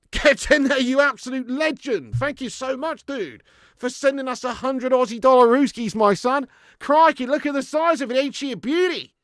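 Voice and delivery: loud, high-pitched hype for the donor; excited celebration. Real, not acted. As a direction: whoop up a big thank-you. Celebrating an Australian viewer's donation.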